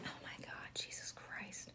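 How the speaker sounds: barely voiced oath under the breath; whispered exclamation. Real, not acted. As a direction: whisper it to yourself in dismay. Muttered reaction to the card.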